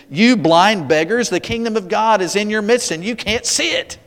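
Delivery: Jesus rebuking. Acted. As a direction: scold them as blind, pitch rising. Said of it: "It's as if Jesus is saying to the Pharisees".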